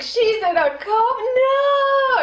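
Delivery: high, loud, tearful disbelief building to a shouted no; mock dramatic dismay. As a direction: ham up a melodramatic wail.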